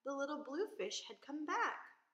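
ordinary delivery, nothing marked

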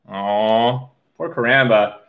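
ordinary delivery

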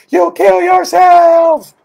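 high, slow, piercing shriek at the husband; imitated scream. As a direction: scream it like a frantic wife. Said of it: "that woman would scream at her husband".

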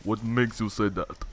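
put-on accented, in-character question; Doomfist impression. Questioning a player who doubts him, Doomfist impression. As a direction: stay in the accented hero voice. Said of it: "that's a real good voice impression".

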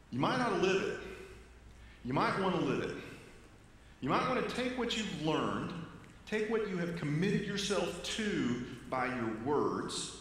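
preaching. Acted. Pastor builds to a call.

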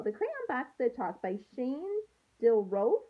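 put-on gentle storybook read-aloud for young students; picture book read to a class; reading to children. Teacher reads picture book aloud.

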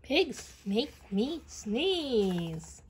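ordinary delivery, nothing marked

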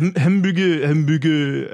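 put-on lightly done French-accented "Hamburger"; a hint of French accent on "Hamburger"; Peter Sellers impression. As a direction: mimic the Pink Panther inspector's mangled "Hamburger". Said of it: "He's like".

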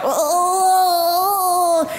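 high, put-on cry of surprise; mock dismay. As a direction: play their dismay at being passed. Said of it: "so they were like".

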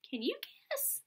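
high teasing ask; high sing-song can you guess; playful question. Acted. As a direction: toss the question up high to the kids. Asks kids teasing question.

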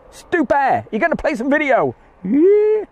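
very high, squealed cheer; hammed-up high-pitched delight; mock excitement. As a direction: play an over-excited fan, rising to a squeal. Acts out thrilled audience.

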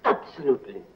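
ordinary talk

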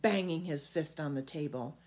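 ordinary delivery

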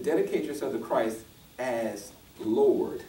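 AS and Lord set apart, drawn out slowly; slow right down and weight "as Lord"; stress on AS LORD. Shown as a demonstration. Preacher correcting "just to Christ", stress on AS LORD. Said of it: "Didn't say just to Christ".

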